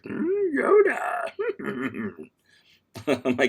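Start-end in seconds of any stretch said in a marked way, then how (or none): 0.0-2.3 much higher, slow creaky Yoda; slow high Yoda croak offered to viewers. Shown as a demonstration. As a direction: stretch it out like the little green master. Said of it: "in my best Yoda voice".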